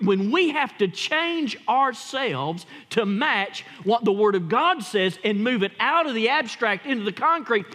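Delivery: usual speaking voice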